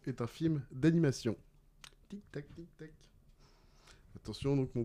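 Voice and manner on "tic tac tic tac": very soft tick-tock; ticking clock imitation. Quizmaster waits for answers, softly ticking. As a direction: softly play the clock while players think.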